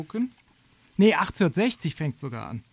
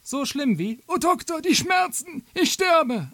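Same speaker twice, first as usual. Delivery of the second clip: much higher, loud, wailing pain; woman in labour; 10 semitones higher, 4 dB louder, slower than usual. Acted. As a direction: shriek out the labour pains. Gebärende Martha klagt. (hohe, klagende Gebärende)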